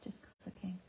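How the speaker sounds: a soft cheeky whisper; whispered aside. Real, not acted. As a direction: breathe it as a private joke. Cheeky aside while colouring.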